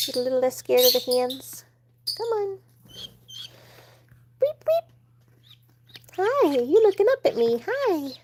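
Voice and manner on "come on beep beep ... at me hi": very high cooing voice to the chick; pet baby talk. Acted. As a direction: coo at the baby bird. Cooing at baby bird Daffy.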